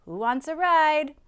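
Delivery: loud, high rising call; cheerful invitation. A narrator's reading. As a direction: call out to all the friends. Truck calling animals to climb in.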